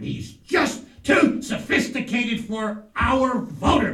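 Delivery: big drawn-out declaration; emphatic proclamation. Acted. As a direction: punch each word out slowly and loudly. [name]'s emphatic punchline.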